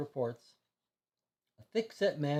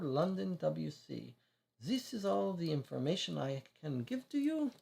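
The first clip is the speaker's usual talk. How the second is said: put-on higher French-accented reading of records; 6 semitones higher than usual. Consul reading the woman's record aloud, French-accented. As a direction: read the file in a careful French accent. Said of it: "He has a slight French accent".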